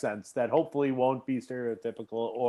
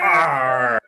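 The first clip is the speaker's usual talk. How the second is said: pirate growl; 5 semitones lower, 4 dB louder than usual. Acted. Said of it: "Can everyone do a pirate accent".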